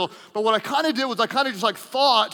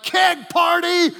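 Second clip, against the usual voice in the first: party shout; 9 semitones higher, 5 dB louder, slower than usual. Retold or imitated. Party guy contrast quote.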